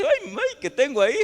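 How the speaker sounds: squeaky high startled cry, laughing; preacher playing a startled sister, high-pitched mock surprise; mock alarm. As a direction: ham up the panic at a mirror. Mock woman noticing mucus.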